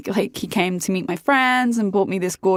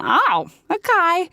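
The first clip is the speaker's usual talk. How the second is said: surprised realization; 9 semitones higher, slower than usual. Retold or imitated. Surprised he is Australian.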